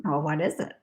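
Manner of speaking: soft, low, curious aside; hushed question. Pretend. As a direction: ask it very quietly. Voices an eager question, first quietly.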